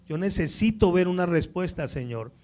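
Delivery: loud, high, impassioned preaching; preaching at full force. Acted. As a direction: drive the point home with fire. Predica con toda la fuerza. (predicación fervorosa a todo pulmón)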